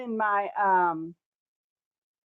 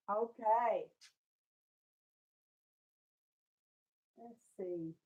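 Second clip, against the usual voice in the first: quiet; 6 dB quieter than usual. Real, not acted. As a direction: keep it quiet and tired. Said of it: "I'm being quiet".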